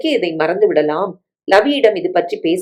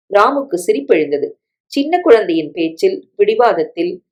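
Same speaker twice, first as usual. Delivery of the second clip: usual speaking voice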